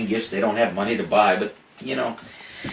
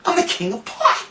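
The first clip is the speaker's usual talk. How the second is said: high hammed-up disbelief; mock outrage. To the manager about the drug test, high mock outrage. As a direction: throw it up high, playing outraged. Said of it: "I said".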